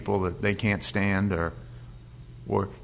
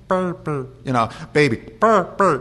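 higher, mock garbled "burr, burr"; mocking garbled repeat; 5 semitones higher, 4 dB louder than usual. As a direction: imitate a just-healed man parroting back badly. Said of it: "And then they'd say".

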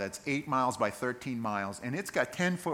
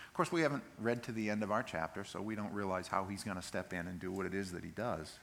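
normal talking voice

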